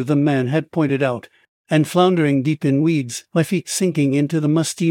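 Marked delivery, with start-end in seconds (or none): none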